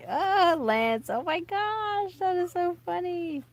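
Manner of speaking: high, loud, drawn-out squeal; fangirl squeal. Real, not acted. Fan looking at her old boy-band pictures, squealing.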